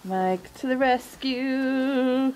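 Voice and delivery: drawn-out playful hero announcement; mock-heroic call. Cheering Mike on at the flaming grill, mock-heroic call.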